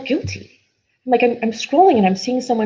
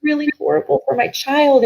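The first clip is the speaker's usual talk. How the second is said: higher, strained voice of a guilty parent; voicing other parents; 9 semitones higher than usual. Mother on a live video voicing parents who felt the same, higher and strained. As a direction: lift the voice up high, echoing the parents' guilt. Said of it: "community of people that were like".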